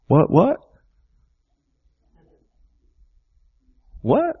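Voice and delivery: high, rising "what?"; puzzled, squeaky-high double take; baffled. Acted. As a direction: play a bewildered listener, voice shooting up. Acts out a baffled listener's reaction.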